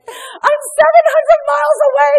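the helpless husband shouting down the phone; frantic husband. Retold or imitated. Husband yells at his crying wife that he is too far away to help. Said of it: "he was like".

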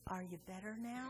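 very soft, cautious question; tiptoeing around his wife; timid husband. Retold or imitated. Husband checks on his wife hours later, very softly and cautiously. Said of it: "he's like".

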